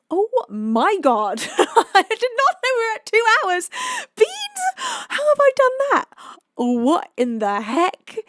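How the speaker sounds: loud, high-pitched disbelief; shocked exclamation. Real, not acted. Streamer realising they have been live two hours, shocked. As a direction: cry out in laughing shock.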